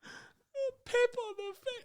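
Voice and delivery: put-on very high, slow squeaky kid voice; hammed-up child whimper; whiny little boy. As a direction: imitate a tearful small boy. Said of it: "And he's like".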